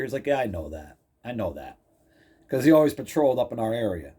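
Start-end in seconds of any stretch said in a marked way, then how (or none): 0.3-1.8 low, quiet knowing reply; imitated cop. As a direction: imitate an officer, pitched down and soft.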